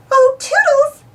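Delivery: high, loud sing-song call. Acted. Daisy calls Toodles for help, high loud call. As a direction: call Toodles across the yard. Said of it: "she called".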